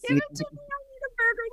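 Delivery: very high, soft pleading squeak; hammed-up hungry squeal; mock craving squeal. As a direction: squeak out a desperate burger craving. Mock Oprah craving burgers.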